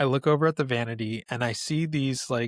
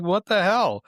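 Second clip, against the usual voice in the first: high, louder startled outburst; shocked what the hell; 7 semitones higher, 5 dB louder than usual. Retold or imitated. Reacting to mom's ghost story, loud disbelief. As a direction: blurt it out in disbelief. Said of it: "I'm like".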